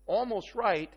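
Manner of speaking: ordinary talk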